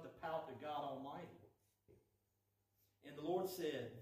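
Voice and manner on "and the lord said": ordinary talk, nothing marked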